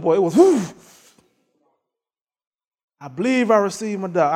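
ordinary talk, nothing marked

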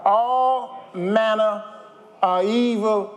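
slow, loud, drawn-out scripture; full-force preaching. Acted. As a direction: lean into each word like a pulpit climax.

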